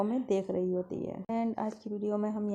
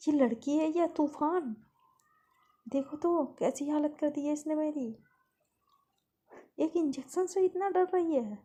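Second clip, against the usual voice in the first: higher, exasperated nurse; fed-up complaint; 7 semitones higher than usual. Acted. Nurse complaining about the girl to the caretaker, exasperated. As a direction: vent your frustration. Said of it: "तो कहती".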